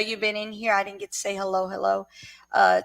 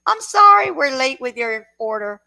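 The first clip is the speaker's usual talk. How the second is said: mock apology voice; 3 semitones higher, 8 dB louder than usual. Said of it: "that they don't say".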